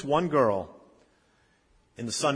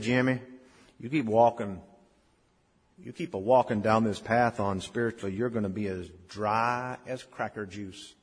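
put-on North Carolina accent; 2 semitones lower than usual. Kindly older man warning a drifting boy, North Carolina accent. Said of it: "with that North Carolina accent".

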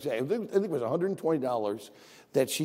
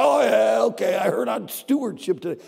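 mock dismissive churchgoer; 6 semitones higher, 6 dB louder than usual. Mocking a careless churchgoer.

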